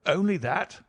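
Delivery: sharp suspicious question; keen, probing. A narrator's reading. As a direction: probe her, eyes narrowed. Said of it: "looking at me keenly".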